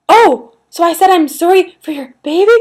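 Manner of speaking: gasp, then high, loud mock horror; shocked. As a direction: imitate her appalled realization. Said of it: "she was so shocked".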